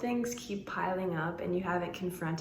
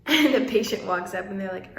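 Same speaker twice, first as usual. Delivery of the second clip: ordinary talk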